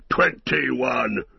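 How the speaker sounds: mildly cried-out dwarf tally; Gimli. A narrator's reading. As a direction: call out the count with restrained triumph. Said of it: "cried Gimli".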